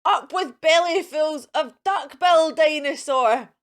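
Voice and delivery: roaring war cry; fierce shout. Acted. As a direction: bellow it like a war chant. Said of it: "And they shouted".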